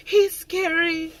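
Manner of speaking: high, slow timid whine; hammed-up scared private whimpering; whiny scared female. Private explaining her fear of the sergeant, mock whine. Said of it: "She was like".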